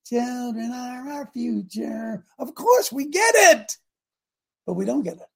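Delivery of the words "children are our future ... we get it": slow singsong rising to a high, loud protest; mock agreement. Preacher parroting what people say about children, mockingly emphatic. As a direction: parrot the slogan, then exclaim it high.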